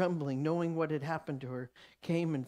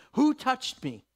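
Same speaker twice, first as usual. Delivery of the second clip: louder higher question; 7 semitones higher, 7 dB louder, slower than usual. Acted. Jesus asks loudly.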